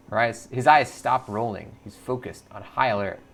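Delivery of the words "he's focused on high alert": normal talking voice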